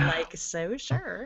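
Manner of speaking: put-on slow Valley girl accent drawl. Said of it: "sound like a Valley girl".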